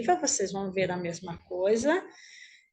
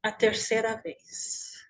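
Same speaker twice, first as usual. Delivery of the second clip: guided meditation; 3 dB quieter than usual. Acted. Teacher leading the group lying on imagined grass, guided meditation. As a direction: guide them gently inward, unhurried, leaving room to breathe.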